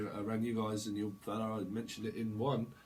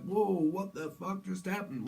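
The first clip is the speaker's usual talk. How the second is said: shocked; 7 semitones higher, slower than usual. Acted.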